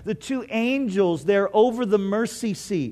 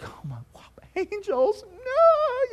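squealing high-pitched gasp at angels, then a high no; mock amazement; 13 semitones higher than usual. Preacher mimics Mary not being awed by angels, mock gush. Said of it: "she's not like".

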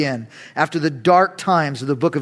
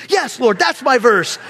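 ordinary talk

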